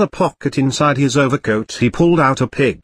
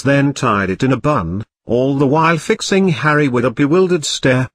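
ordinary delivery, nothing marked

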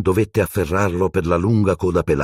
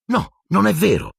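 disbelieving; 13 semitones higher than usual. A narrator's reading. Harry protests in disbelief.

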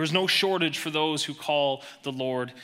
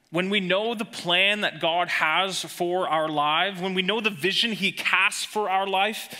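rising, much louder preaching; building sermon; 6 semitones higher, 4 dB louder than usual. Acted. Preacher to church, building to a loud climax. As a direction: preach it up to full force.

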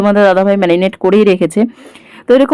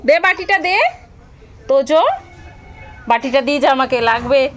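real parental call, pitched up; 6 semitones higher, 4 dB quieter than usual. Real, not acted. Mother calls child for bowl.

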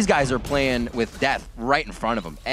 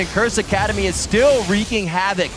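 raised-pitch caster recap, gradually settling; animated play-by-play; 8 semitones higher, 5 dB louder than usual. Real, not acted. Caster summing up a chaotic fight for viewers, animated play-by-play. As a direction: keep the fight's excitement going, then ease down.

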